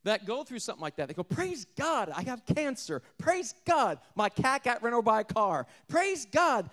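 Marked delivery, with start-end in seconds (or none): 1.2-6.7 high, loud singsong mocking a pious believer; mock pious praise. Preacher mimicking a gushing believer, high and loud. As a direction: ham up the gushing churchgoer.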